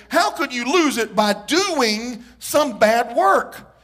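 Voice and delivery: usual speaking voice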